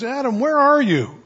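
God calling for Adam. Retold or imitated. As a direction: call out across the garden.

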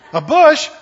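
loud mocking disbelief; incredulous. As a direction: scoff at the idea. People doubt Moses' story.

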